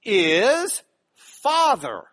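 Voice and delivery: Father drawn out very slowly, weighted; stress on Father. Shown as a demonstration. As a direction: lean on Father, stretching it. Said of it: "The first word in the Lord's Prayer".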